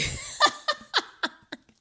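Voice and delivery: loud high burst of laughter. Real, not acted. Laughing about family packing her car, high cackle.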